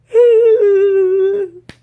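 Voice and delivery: one long, loud, high wail; imitated weeping wail. Patient reacting to pregnancy news, loud acted weeping wail. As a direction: burst into a drawn-out mock sob.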